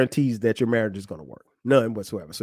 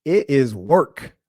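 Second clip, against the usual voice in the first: slow emphasis; much slower than usual. Real, not acted.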